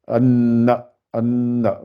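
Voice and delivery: "anna" slow, with a long held n; doubled n. Shown as a demonstration. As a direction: linger on the n in "anna". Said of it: "Si j'avais un N".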